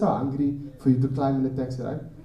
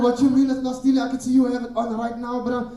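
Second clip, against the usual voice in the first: incredulous; 8 semitones higher than usual. Retold or imitated. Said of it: "I was like".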